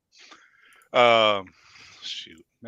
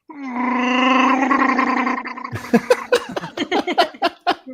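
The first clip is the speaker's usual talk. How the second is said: gargled Wookiee roar, as if underwater; bubbly high Chewbacca roar, done on request; Chewy underwater roar; 13 semitones higher, 7 dB louder than usual. Pretend. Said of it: "I'll do Chewy underwater".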